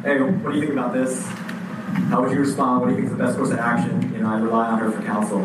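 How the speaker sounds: ordinary talk, nothing marked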